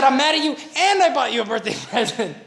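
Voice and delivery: imitating the wife, laughing through the line; laughing mock wife quote. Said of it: "She said".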